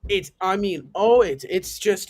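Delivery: ordinary talk, nothing marked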